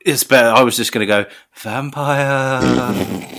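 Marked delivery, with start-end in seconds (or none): 1.6-3.4 drawn-out ghostly vampire; low haunting voice. Acted. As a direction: moan the word like a ghost. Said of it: "say vampire in a ghost voice".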